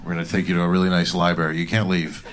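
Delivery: low, fake-soothing cop voice; deep and falsely reassuring; low officer voice, fast on the library line; mock officer. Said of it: "ice was like".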